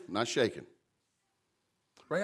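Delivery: usual speaking voice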